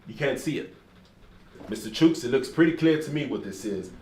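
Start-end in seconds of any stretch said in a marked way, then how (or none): none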